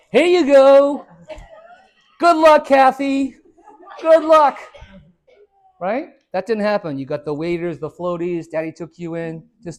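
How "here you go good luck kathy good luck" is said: high, loud, laughing send-off; mock cheery parent. Parent tossing little Kathy into the pool, laughing mock cheer. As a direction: chirp it like a parent throwing the kid in.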